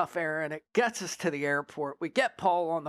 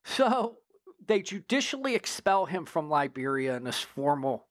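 ordinary talk, nothing marked

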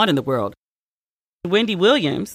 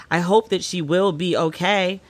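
ordinary delivery

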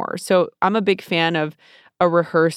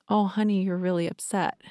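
tender self-soothing; 6 dB quieter than usual. Shown as a demonstration. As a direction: comfort yourself like a child, softly. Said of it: "I just say".

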